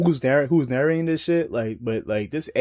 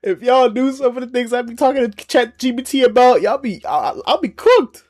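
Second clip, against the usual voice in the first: mock panic; 11 semitones higher, 4 dB louder than usual. Real, not acted. Joking confession about chatbot chats.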